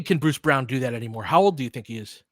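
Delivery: ordinary talk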